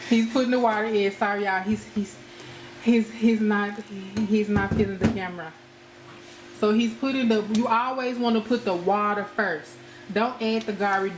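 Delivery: ordinary delivery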